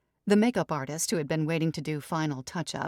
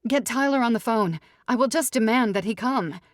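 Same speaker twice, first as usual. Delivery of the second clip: loud, higher demanding snap; snapping bride; 6 semitones higher, 6 dB louder than usual. Acted. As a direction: bark it out imperiously. Ruby demands.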